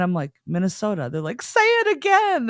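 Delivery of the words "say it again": very high, loud eager demand; hammed-up delighted request; imitating excited strangers. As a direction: squeal it like a fascinated stranger. Strangers excitedly want accent repeated.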